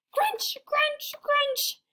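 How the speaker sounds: high shrill crunching; high crunches. Acted. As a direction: chomp chomp chomp in glee. Sammy bites the teacher.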